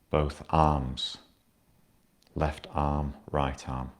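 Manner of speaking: guided meditation. Acted. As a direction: ease the listener down into stillness. Guides a body scan.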